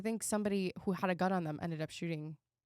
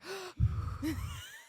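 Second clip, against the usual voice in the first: a sharp mock gasp, higher; gasp for breath; 10 semitones higher than usual. Telling a friend about the shooting range, sharp gasp. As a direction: act out the sudden gasp for breath. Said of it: "And I'm like".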